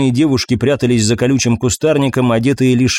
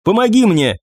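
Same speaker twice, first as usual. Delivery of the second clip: higher, urgent call for help; strained plea in a raised pitch; tense; 8 semitones higher than usual. A narrator's reading. Commander calls for help after the hit, tense. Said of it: "раздался напряжённый голос командира".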